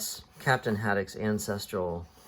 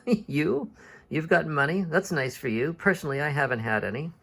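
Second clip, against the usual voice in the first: very high disbelieving "you", easing into sarcasm; acted disbelief at a friend's claim; incredulous scoff; 6 semitones higher than usual. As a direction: scoff at the offer, pitched up. Haddock scoffs at Calculus's claim.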